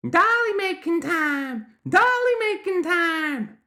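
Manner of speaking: loud, very high, drawn-out chant; slow, insistent gremlin squeal; stubborn gremlin child. A narrator's reading. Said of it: "stubborn as only a child could be".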